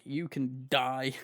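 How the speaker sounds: slow, quiet deadpan. Retold or imitated. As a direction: say it slow and flat, fed up. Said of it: "You're like".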